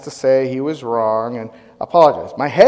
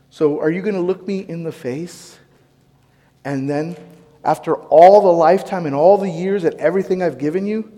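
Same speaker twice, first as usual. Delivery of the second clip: usual speaking voice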